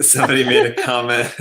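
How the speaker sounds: laughing through the words; cracking up mid-story. Real, not acted. Laughing as he tells the friends the comment.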